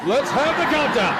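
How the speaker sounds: loud, high announcer call. Retold or imitated. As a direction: boom it out to the room. Announcer calling out countdown.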